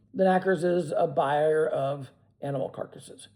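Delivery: plain reading voice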